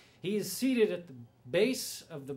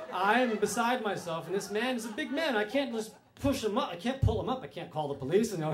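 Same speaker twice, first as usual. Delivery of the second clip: normal talking voice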